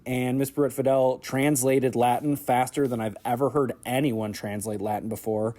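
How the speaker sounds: ordinary talk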